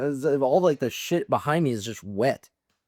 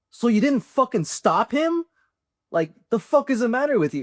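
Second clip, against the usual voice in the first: exasperated yelling; 13 semitones higher, 4 dB louder than usual. Retold or imitated. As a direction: tear into him, high and incredulous. Berating buddy for not stopping.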